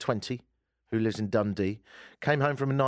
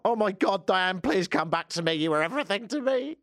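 put-on high, wailing jilted boyfriend; hammed-up lovesick begging; mock heartbroken plea; 8 semitones higher than usual. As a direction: plead hammily, climbing higher to the end. Acts out jilted boyfriend pleading.

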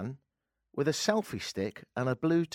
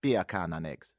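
"Beer can" run together as Jamaican "bacon", lower; lower-pitched mock Jamaican "bacon and eggs"; Jamaican accent; 6 semitones lower than usual. Shown as a demonstration. Host showing listeners his one Jamaican phrase, mock Jamaican accent. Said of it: "I can say in Jamaican".

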